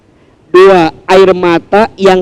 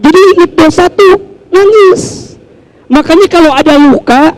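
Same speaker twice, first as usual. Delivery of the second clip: normal talking voice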